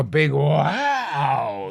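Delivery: WOW stretched long with a swooping pitch; emphatic wow. Real, not acted. Man explaining what her interest meant, long swooping "wow". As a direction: draw out "wow", letting it soar. Stressing how much it meant.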